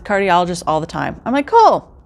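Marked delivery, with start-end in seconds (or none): 1.5-1.9 a much higher, louder, upbeat "Cool"; bright agreement. Retold or imitated. Answering her friend's referral, an upbeat high "Cool". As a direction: pop it out high and cheery.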